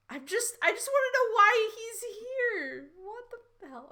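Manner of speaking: high, irritated complaining outburst; exasperated Lysander. Acted. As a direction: vent it like a sulky grumpy man. Reading Lysander's exasperated line.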